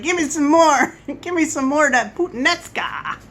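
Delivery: hammed-up hungry spouse, laughing midway; eager Italian spouse. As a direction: beg for seconds like an Italian spouse. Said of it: "Especially if your spouse is Italian".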